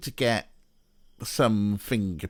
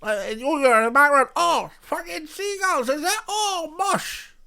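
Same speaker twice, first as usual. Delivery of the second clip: very high-pitched Swansea caricature; hammed-up Swansea accent, far above normal pitch. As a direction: screech it up high like a cartoon Swansea local. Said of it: "'Cause they go".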